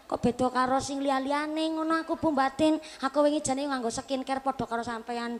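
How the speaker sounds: ordinary talk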